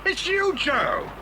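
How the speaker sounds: high, slow amazed recognition; Bill amazed to find his colleague Joe; astonished relief. Acted. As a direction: gasp it out with relief.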